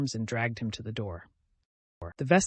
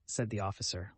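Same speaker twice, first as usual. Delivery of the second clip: ordinary delivery, nothing marked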